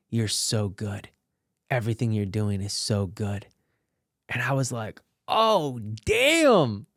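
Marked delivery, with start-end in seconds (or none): 0.1-3.5 imitating the director's easy praise; hint of the director. Director praising the actor on set, a lightly voiced low compliment. 5.3-6.9 surprised. Retold or imitated. Stunned by director's praise.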